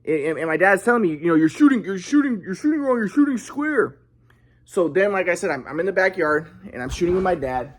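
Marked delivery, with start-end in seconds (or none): 1.3-4.0 raised pitch, mock scolding; critical dad. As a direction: imitate an exasperated father correcting a shot. Retold dad scolding his shooting.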